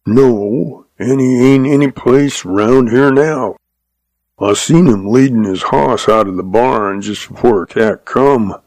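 ordinary delivery, nothing marked

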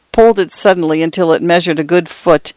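ordinary delivery

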